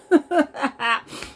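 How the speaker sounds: loud, high pulsed exhale; high, loud huffing release; breathy outburst. Real, not acted. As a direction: let the breath burst out. Breaks off while venting.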